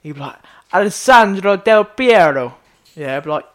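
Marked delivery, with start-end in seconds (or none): 0.7-2.6 slow, louder put-on Italian; mock Italian accent. Mocking a YouTuber saying an Italian name, Italian accent. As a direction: Alessandro Del Piero drawn out in an Italian accent. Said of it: "pronounced, like, an Italian name".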